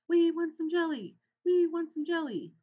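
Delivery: squeaking little mice. A narrator's reading. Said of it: "all the little cousins began to squeak".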